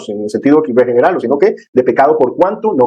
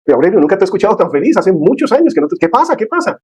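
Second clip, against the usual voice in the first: high, rising, fast amazed questions; amazed friend; 6 semitones higher than usual. Retold or imitated. Friend on the phone asking what happened, amazed. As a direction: voice climbing in disbelief.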